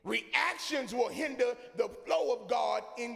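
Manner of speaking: loud, fervent sermon delivery; whooping preacher building to a cry; full-force preaching. Real, not acted. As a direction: preach it hard over the organ.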